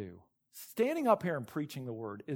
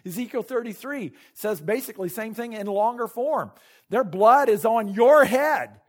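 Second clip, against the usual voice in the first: loud, urgent pulpit preaching; preaching at full force; 6 semitones higher, 8 dB louder than usual. Acted. Pastor urging the church on Paul's warning, forceful preaching. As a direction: drive every line home to the congregation.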